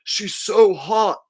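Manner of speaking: slow, high, mock-lusty guy; imitating a smitten guy, drawn out and high; mock dude voice. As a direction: ham up a drooling dude. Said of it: "guys who are like".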